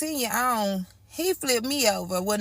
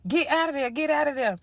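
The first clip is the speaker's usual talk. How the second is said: higher, pressing voice of a scared mother; urgent mom; 5 semitones higher than usual. Retold or imitated. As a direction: tell him to get out like a worried mom. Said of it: "My mama called".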